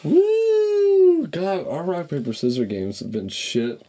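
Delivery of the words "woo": loud, high whoop; excited cheer. Real, not acted. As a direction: cheer the win. Cheers at the result.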